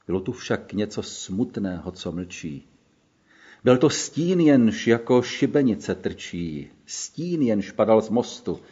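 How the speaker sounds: normal talking voice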